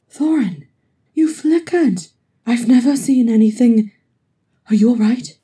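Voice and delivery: high, loud alarmed burst; worried. Acted. Farin grabs the flickering Thorin, worried and loud. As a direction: blurt it out in rising alarm. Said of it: "worry in his voice".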